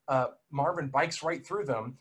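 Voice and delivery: normal talking voice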